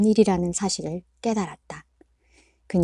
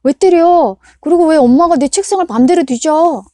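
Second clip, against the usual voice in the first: bratty protest; 9 semitones higher, 10 dB louder than usual. A narrator's reading. Son talking back.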